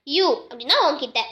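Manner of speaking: ordinary delivery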